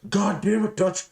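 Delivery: high raspy troll, mock yelling; hammed-up scolding in a squeezed, high rasp; Cheese yelling. Coworker coming at him like a troll, mock raspy yell. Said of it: "you know, yelling at you".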